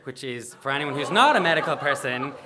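ordinary delivery, nothing marked

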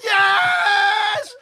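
high shouted yes; victory cry. Retold or imitated. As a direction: punch the air with it.

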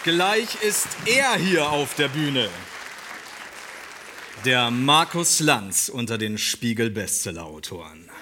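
mock-epic stage introduction, swelling; grand entrance announcement. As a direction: announce him like a boxing champ.

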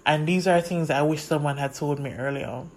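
normal talking voice

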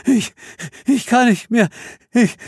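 high, broken, exhausted whimper; terrified horror victim. Acted. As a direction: gasp out the last words. Dying exhausted dancer begs. (verzweifeltes, gebrochenes Wimmern)

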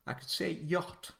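a hint of a demure English accent on yacht; mock posh English accent. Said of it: "that's a terrible English accent".

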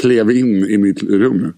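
normal talking voice

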